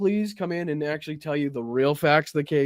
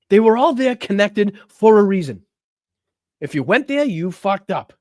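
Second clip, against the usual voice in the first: heated rant; 3 semitones higher, 6 dB louder than usual. Real, not acted. Ranting at the guest on stream.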